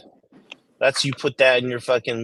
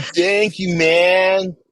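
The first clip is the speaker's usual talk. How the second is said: surfer voice; 7 semitones higher, much slower than usual. Shown as a demonstration. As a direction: shout it like a beach bro. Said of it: "How about if I say it like a surfer?".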